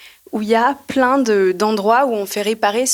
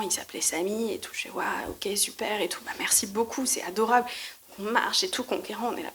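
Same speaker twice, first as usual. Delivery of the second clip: whisper; 11 dB quieter than usual. Retold or imitated. Hushed retelling while walking.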